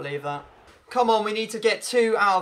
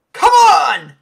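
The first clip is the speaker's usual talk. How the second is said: high, loud pleading come on; tense shouts at the screen; desperate shouts; 9 semitones higher, 9 dB louder, much slower than usual. Real, not acted. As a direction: urge the ball out at full volume. Desperate as opponent attacks.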